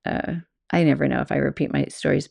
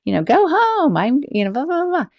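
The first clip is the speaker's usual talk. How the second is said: much higher and louder, playful scolding; mock scolding shriek; 11 semitones higher, 5 dB louder than usual. Scolding the masked man she thinks is a friend, high playful shriek. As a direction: throw the energy right back, high and loud. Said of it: "gave all that energy of".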